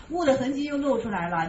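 usual speaking voice

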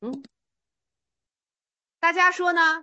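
ordinary talk

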